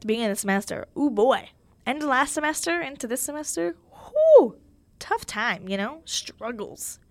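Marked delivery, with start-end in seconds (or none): 3.9-4.6 loud, high whoop of relief; sharp high-pitched exclamation over hard times; big "whew". Real, not acted. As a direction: let out a high, loud "whoo" at how rough it was. Exclaims over a tough semester.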